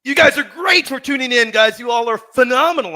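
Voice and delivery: loud, high hype yell to the viewers; loud thrilled thanks to the audience; excited shout-out. Real, not acted. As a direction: blast it out to the chat with real delight.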